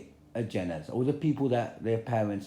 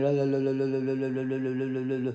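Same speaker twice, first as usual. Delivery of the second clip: rapid monotone la-la-la babble; mocking la-la-la; faster than usual. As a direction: imitate someone blocking out what they hear. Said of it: "fingers up your ears not to hear anything".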